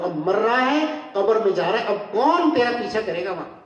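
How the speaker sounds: ordinary talk, nothing marked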